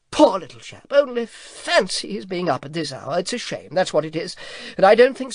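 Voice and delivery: a fussy dragon taking charge; brisk dragon. A narrator's reading. Dragon fusses over the boy and hurries them off, brisk. Dragon bustling, voiced figure.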